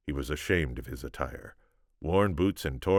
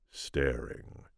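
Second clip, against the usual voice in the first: plain reading voice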